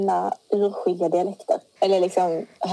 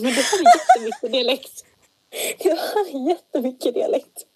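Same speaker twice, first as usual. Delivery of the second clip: laughing; 5 semitones higher than usual. Real, not acted. Laughing about the accent.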